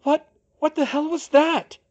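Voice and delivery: very high, loud, panicked cry; terrified, pitch leaping way up; frightened whimper. A narrator's reading. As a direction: stammer it out in shrieking fright. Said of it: "Hermione whimpered".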